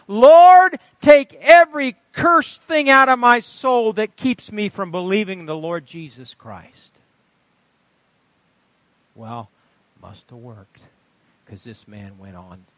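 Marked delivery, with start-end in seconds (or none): 0.1-7.0 cried-out prayer, high and very loud, settling by the end; impassioned prayer. A narrator's reading. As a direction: call on the Lord at full force, then ease down. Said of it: "came out of the hut praying".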